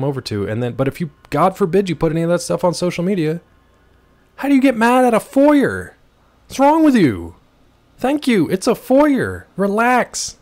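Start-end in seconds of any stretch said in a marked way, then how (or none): 4.4-10.4 high, loud mock rant at critics; mock outrage. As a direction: rant in a raised exasperated voice. Ranting at online critics.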